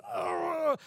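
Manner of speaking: high imitated demon growl. Preacher imitating the demon in the man, high growl. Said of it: "the demon was just kinda".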